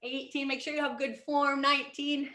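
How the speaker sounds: steady fitness coach count; workout counting. Acted. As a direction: count reps for the class. Counting squats with class.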